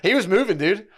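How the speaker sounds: real laughter on the brag; laughing. Real, not acted. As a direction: crack up while bragging. Laughing about his dancing.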